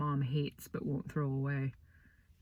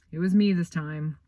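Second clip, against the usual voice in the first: usual speaking voice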